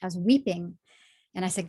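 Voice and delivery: normal talking voice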